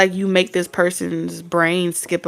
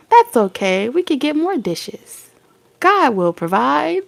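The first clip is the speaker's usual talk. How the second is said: sugary reassurance; 6 semitones higher than usual. Pretend. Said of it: "you're supposed to be like".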